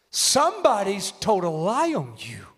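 usual speaking voice

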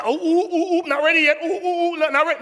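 high, panicky ooh-ing unready bride; flustered bride. Acted. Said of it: "You don't have no time to say".

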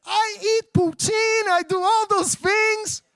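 high, drawn-out proud claim; hammed-up, slow and high-pitched bragging; mock boasting. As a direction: play someone insisting on their credentials. Acts out a boasting would-be Canadian.

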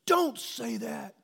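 high, slow, stretched plea in a daughter's voice; the daughter pleads with her father in the hallway, high and slow; daughter pleading. Retold or imitated.

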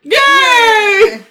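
high, loud delighted yay; thrilled cheer. Real, not acted. Player cheering her cat girl result. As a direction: squeal with joy at the result.

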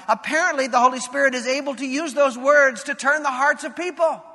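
rising preaching climax. Acted. Pastor urging his congregation to stop running from God, preaching at full force.

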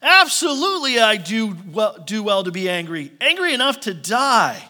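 high, loud, huffy insistence; indignant Jonah. Retold or imitated. Jonah answering God, loud and indignant. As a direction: stubbornly defend your anger. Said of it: "Jonah's like".

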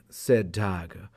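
plain reading voice

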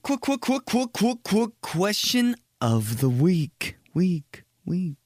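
high stutter, then slow echo; stuttering segment jingle. Pretend. Host announcing the question of the week, mock stuttering echo. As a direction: mimic a remix intro, repeating syllables.

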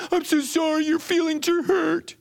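very high, hammed-up coddling voice; mock sympathy. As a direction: coo false pity in a high singsong. Mocking a coddling God.

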